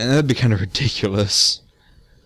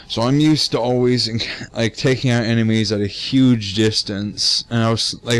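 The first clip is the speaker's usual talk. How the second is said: ordinary talk, nothing marked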